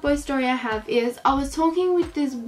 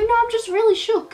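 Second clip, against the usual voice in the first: high, bright-eyed insistence; excited protest; 10 semitones higher, 4 dB louder than usual. Retold or imitated. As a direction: squeal that you're fine, just stunned. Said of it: "I was like".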